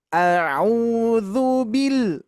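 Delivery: bil ditekan naik lalu turun panjang; stress on bil. Shown as a demonstration. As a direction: tekan suku kata "bil" lalu tahan. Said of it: "ada penekanan ke atas". (tekanan pada "bil", dibaca pelan)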